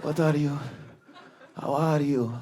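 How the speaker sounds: put-on soft, slow old Lebanese man; subdued old Lebanese heckler. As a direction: imitate an elderly Lebanese shopkeeper, quietly. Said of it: "it's so slow. He's like seventy years old, Lebanese man".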